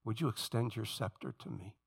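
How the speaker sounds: a lowly, pleading prayer on the face; a hushed prayer of submission; humble plea. Retold or imitated. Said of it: "Then I say".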